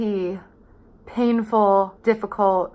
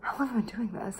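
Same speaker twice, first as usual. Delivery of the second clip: whispered to herself, much softer; whispering; 13 dB quieter, much faster than usual. Real, not acted. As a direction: breathe the question under your breath. Repeats question to herself, whispered.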